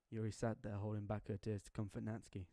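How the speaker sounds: plain reading voice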